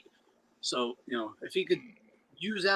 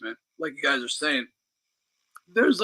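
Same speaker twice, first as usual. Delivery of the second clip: usual speaking voice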